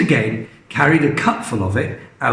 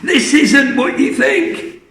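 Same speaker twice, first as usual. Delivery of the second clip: alarmed plea; 11 semitones higher than usual. Acted. George begging Grandma not to drink, high urgent. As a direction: stop her in a panic.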